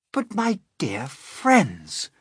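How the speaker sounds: high, slow, determined cheer; high, loud forced cheerfulness; trying to be cheerful. Acted. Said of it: "trying to be cheerful".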